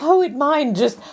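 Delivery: ordinary talk, nothing marked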